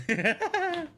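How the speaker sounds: high-pitched burst of laughter. Real, not acted. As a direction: break into high, quick laughter. Laughing after own reply.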